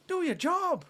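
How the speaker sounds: high, scolding mock Irish accent; Irish pundit's reprimand, pitched high; Roy Keane. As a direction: order them sternly in a put-on Irish voice. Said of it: "am I sounding like Roy Keane?".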